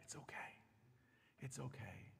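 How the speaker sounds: hushed, soothing reassurance; soothing Satan. Acted. Satan soothing the listener into sin, hushed. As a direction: lull them like a soothsayer. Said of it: "Satan comes like a soothsayer".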